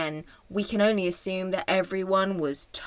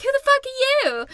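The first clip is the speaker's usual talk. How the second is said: mock Barbie; faster than usual. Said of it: "And Barbie's like".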